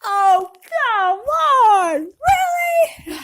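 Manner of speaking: loud, slow, high protest; retold outburst in the theater; yelled out. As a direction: yell it at the movie screen. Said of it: "I yelled out".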